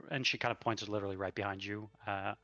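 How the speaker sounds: normal talking voice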